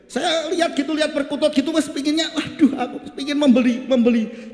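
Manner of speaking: high, excited self-mockery; comic, hungry longing for the dove; eager craving. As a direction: act out wanting to buy the bird. Acts out his craving for a bird.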